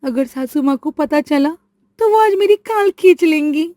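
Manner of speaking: a frightened bride who spoiled the dish, voice climbing; panicked. Acted. Rajni panics over the salt. (घबराई हुई, ऊँची और तेज़ आवाज़)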